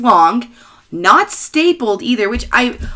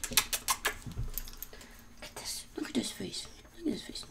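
hushed; 21 dB quieter than usual. Real, not acted. Hushed cooing at dog.

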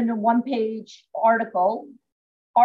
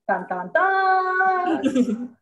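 high, very slow suspense sting; dramatic dun dun dun; 7 semitones higher, much slower than usual. Pretend. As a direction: sing it like a mystery reveal. Dramatic reveal sound effect.

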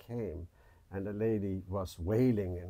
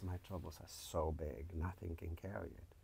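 quiet sorrow; 2 semitones lower, 9 dB quieter than usual. Acted. Sad boy telling his troubles.

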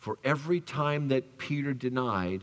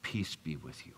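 much lower and much softer blessing; gentle Jesus; 9 semitones lower, 10 dB quieter than usual. Retold or imitated. Jesus greeting the frightened disciples, low and hushed. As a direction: breathe the blessing low and soft. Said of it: "and said first".

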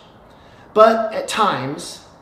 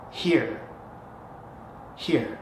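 gentle offering; 5 semitones lower, 9 dB quieter than usual. Shown as a demonstration. Acting out handing something over.

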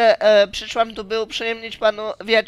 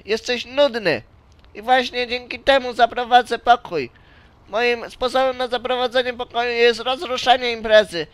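normal talking voice

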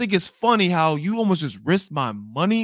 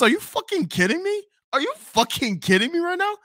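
high, incredulous, rising fury; outraged disbelief. Retold or imitated. Scolding his date over the deposit, high outraged disbelief. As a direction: hit every word in disbelief.

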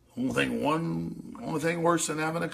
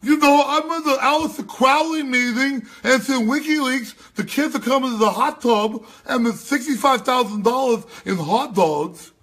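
mock voice; 8 semitones higher, 7 dB louder than usual. Host mimicking the guest's call on companies, high and loud. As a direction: ham it up as a clueless elitist.

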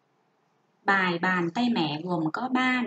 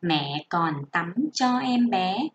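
slow, lower reading voice for pupils; careful classroom reading; model reading aloud; 5 semitones lower, slower than usual. Shown as a demonstration. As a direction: read it as an example to copy. Teacher models reading paragraph two.

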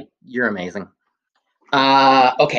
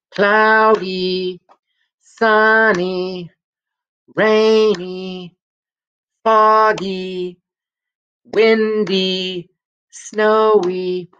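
sing-song list; 8 semitones higher, much slower than usual. Shown as a demonstration. Listing two-syllable weather words.

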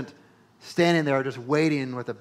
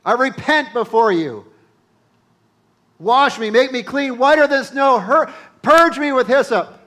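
loud, much higher repentant cry to God; pleading prayer; 10 semitones higher, 12 dB louder than usual. Pretend. Believer confessing a sin to the Lord, loud pleading prayer. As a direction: pour it out like a desperate confession. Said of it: "come boldly before him and say".

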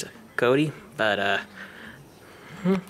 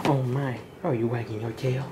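ordinary talk, nothing marked

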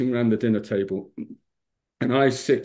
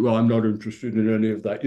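put-on grumpy old man; faster than usual. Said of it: "you know, old school".

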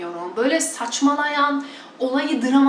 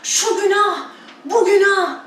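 imitating a preacher scolding, higher; mock finger-wagging sermon; a hint of a shouting preacher; mock preacher yell; 8 semitones higher, slower than usual. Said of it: "bağırıyor".

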